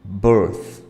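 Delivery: "Birth" loud, with a strong, drawn-out th; exaggerated th practice. Shown as a demonstration. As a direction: push the th at the end of "Birth" hard.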